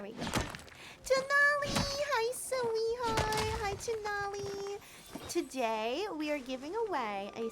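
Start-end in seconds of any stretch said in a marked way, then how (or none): 1.0-4.8 high bright excited hello; excited greeting. Real, not acted. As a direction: greet her with delight.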